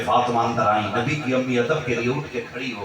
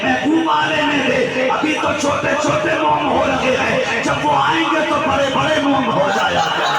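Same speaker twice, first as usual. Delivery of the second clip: ecstatic shout; 11 semitones higher, 4 dB louder than usual. Acted. Preacher voicing the stone's prophecy to the crowd, jubilant shout. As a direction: sound the stone's joyful announcement to the hall.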